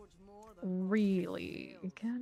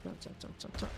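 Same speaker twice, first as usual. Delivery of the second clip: whispering; 13 semitones lower, 10 dB quieter, faster than usual. Real, not acted. Urging character to jump.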